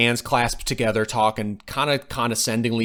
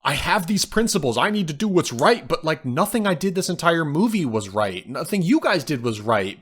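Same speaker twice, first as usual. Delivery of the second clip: higher-pitched, lightly voiced realization; mildly acted dismay; 7 semitones higher than usual. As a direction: protest as if her beliefs are collapsing, lightly. Voices the heroine's shattered realization.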